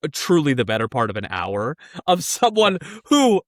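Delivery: plain reading voice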